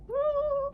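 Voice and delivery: sharp high gasp of surprise; mock gasp. Reader gasps in mock shock at the cat.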